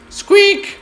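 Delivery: tiny high squeal like a struck bunny; high bunny squeak. Retold or imitated. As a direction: squeak like the hurt rabbit.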